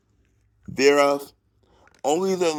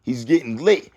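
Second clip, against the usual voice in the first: normal talking voice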